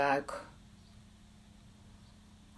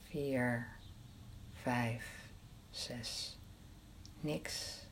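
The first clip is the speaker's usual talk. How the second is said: fading whispered count; 6 dB quieter, much slower than usual. Shown as a demonstration.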